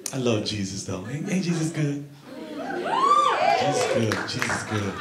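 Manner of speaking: low, soft amen mutter; quiet churchgoer murmur. Pretend. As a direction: murmur it like a pew response.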